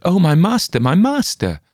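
high, loud anguished shout; servant's shout. A narrator's reading. Servant maid shouting over her dead master, high and loud. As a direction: cry out in horror for the master. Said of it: "The servant maid shouted".

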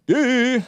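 high burst of laughter; quick amused laugh; short laugh. Real, not acted. Laughs after the joke.

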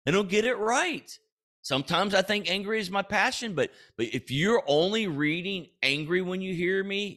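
high-pitched, worked-up protest; heated self-defence. Acted. Mock-heated defence against being called angry.

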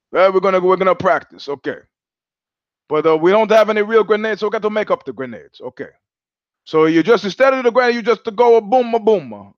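Italian officer. Retold or imitated. Joke officer tells recruits in mock Italian accent. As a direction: ham up an Italian drill sergeant. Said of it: "my old World War Two joke".

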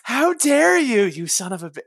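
high slow mock outrage, then trailing off; sputtering foiled villain. Mock outrage at buried lead.

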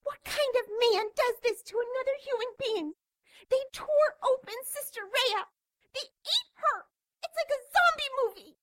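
high, horrified nun voice; panicky, barely holding it in; contained shout. Acted. Frightened nun voiced by player.

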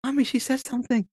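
put-on high, excited child voice; excited little girl. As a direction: tattle to mommy like a toddler. Imitating his oldest daughter.

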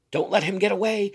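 high loud urgent call; urgent cry. Acted. Woman to the crowd, urgent shout. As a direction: rouse the crowd.

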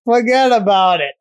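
mock New York. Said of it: "he's like".

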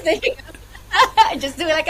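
loud, high-pitched laughing speech. Real, not acted. Guest joking about becoming a podcast host, laughing. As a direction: crack up while playing along with the joke.

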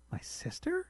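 high, incredulous squeak of surprise; can't believe what she's hearing; shocked disbelief. Acted. Wife shocked by sculpture likeness.

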